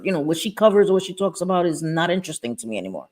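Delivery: ordinary talk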